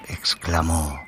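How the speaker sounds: ordinary delivery, nothing marked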